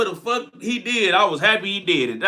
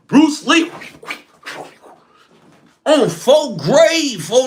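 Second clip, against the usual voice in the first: amazed outburst; 5 semitones higher, 3 dB louder, slower than usual. Real, not acted. Marvelling at friend's porch escape.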